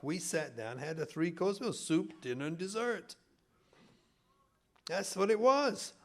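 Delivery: usual speaking voice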